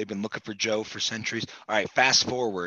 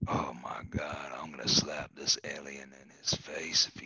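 threatening whisper; Joe Biden whisper; 7 semitones lower, 6 dB quieter than usual. Acted. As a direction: hiss the threat quietly.

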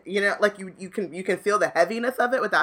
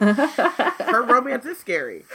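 laughing; 6 semitones higher, slower than usual. Real, not acted. Teasing joke, laughing.

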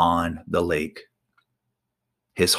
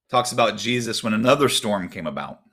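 ordinary delivery

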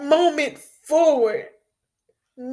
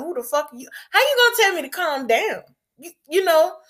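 higher, fast, indignant retort; indignant anger; 7 semitones higher, faster than usual. Retold or imitated. Daughter recalls telling off the officer, fast, higher and indignant. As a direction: fire it back at whoever told you to calm down. Retold rage at being told calm.

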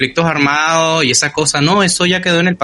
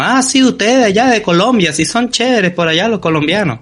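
mock foreigner; 6 semitones higher than usual. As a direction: mimic someone mixing up Venezuelans and Colombians, pitched way up. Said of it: "A ti te han lanzado como venezolano".

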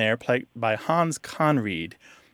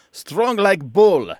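slow, louder mock Lebanese accent catchphrase, pitched up; Uncle Tanoose accent; 5 semitones higher, 6 dB louder, much slower than usual. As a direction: boom it out slowly like a hammy Lebanese sitcom uncle. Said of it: "he says it in what's supposed to be a Lebanese accent".

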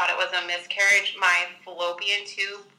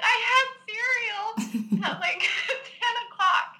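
high, drawn-out, laughing quote; incredulous, pitched way up, breaking into laughter; laughing retelling; 14 semitones higher, slower than usual. Retold or imitated. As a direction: laugh through your own baffled answer. Retells her baffled answer, laughing.

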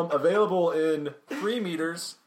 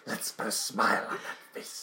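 low, slow creepy whisper; 8 semitones lower, 5 dB quieter than usual. Pretend. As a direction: creep them out, slowly. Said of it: "please never do that again".

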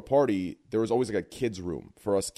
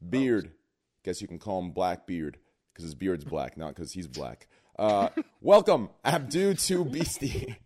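loud ring announcer intro; 5 dB louder, slower than usual. Acted. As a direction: hype up each guest like a boxing announcer.

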